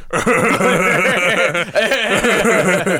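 long put-on chuckle; drawn-out stoner laugh impression; Seth Rogen. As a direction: do a rumbling caricature laugh. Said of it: "Seth Rogen sounds like".

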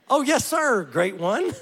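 high, fawning yes-sir voice; butter up the king, hammed-up; mock flattery.

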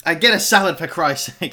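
high-pitched, exasperated self-defence; exasperated protest. Real, not acted. Defending a salad order to a laughing friend, exasperated. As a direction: protest as your voice climbs.

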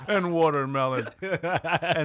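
words squeezed out while cracking up; laughing through it. Real, not acted. Mocking stereotypes, cracking up.

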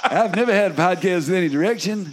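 silly singer impression on the edge of laughing. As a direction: ham up a famous singer's accent. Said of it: "Doing the [name] accent".

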